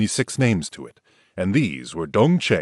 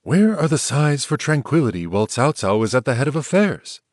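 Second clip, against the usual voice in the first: cautious reply; 7 semitones higher, 4 dB louder than usual. A narrator's reading. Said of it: "so he cautiously replied".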